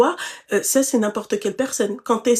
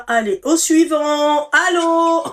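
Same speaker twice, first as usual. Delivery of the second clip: high, loud, drawn-out comic call; 6 semitones higher, 7 dB louder, slower than usual. Acted. Host acts out moving on to the next man, playful loud calling. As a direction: holler for the next one, playfully. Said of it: "je me dirai".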